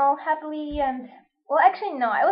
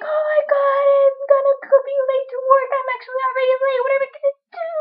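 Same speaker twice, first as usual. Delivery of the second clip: high, fast frantic worry; panicky; 10 semitones higher, faster than usual. Retold or imitated. Realizing she is late for work, high frantic panic. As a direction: rush it in a mock panic. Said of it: "like, all panicky".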